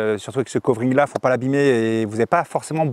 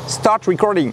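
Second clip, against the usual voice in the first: "Start recording" in a mock English accent, a bit higher; put-on English accent; 5 semitones higher, slower than usual. Shown as a demonstration. Giving the camera a voice command, hammed-up English accent. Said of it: "il faut prendre un petit accent pour que la caméra te comprenne".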